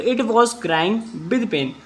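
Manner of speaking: very slow, word by word English; slow dictation. Shown as a demonstration. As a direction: spell it out slowly for the class. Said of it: "repeats sentence for class". (धीमे-धीमे बोलकर लिखवाना)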